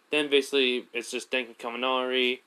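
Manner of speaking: ordinary delivery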